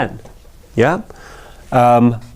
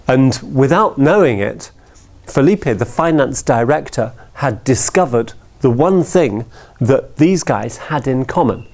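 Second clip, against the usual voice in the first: ordinary delivery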